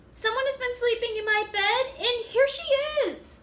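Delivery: put-on squeaky Baby Bear. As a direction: squeak it like a startled little cub.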